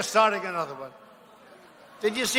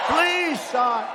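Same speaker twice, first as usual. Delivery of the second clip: higher, hammed-up plea; mock begging; 4 semitones higher than usual. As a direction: imitate a fawning beg, pitched up. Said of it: "they would kiss my ass".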